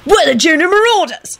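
high-pitched threatening cry; shouted. A narrator's reading. Tim threatening the shirt and socks, shouting. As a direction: proclaim it in a high, contained shout. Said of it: "he shouted".

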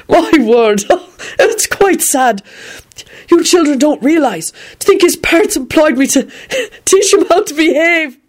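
put-on high, giggly old gent barely holding it together; a high, chortling voice for Quentin; Quentin's laughing voice. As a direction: play Quentin tickled by his own mischief. Quentin chortling over killing story.

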